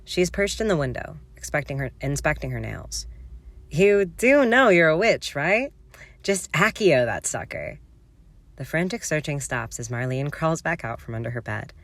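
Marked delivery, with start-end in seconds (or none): 3.7-7.8 bored Mary. Acted. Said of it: "sounding bored".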